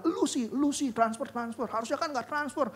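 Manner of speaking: mock the wife's blaming; imitating nagging wife. Wife's blame voiced. (istri menyalahkan suami, nada tinggi mengejek)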